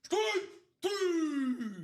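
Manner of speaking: high, loud, drawn-out umpire call; umpire strike call. Shown as a demonstration. Showing the co-host his umpire call, loud and high. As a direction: belt it out like an umpire ringing up a batter. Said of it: "You ready for this one, Alex?".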